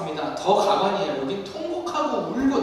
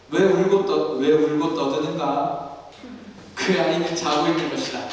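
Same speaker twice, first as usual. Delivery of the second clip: lowered, deliberate pronouncement, a touch of gravitas; solemn voice of Jesus; 6 semitones lower than usual. Shown as a demonstration. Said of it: "제 톤이 잘 안 나와요".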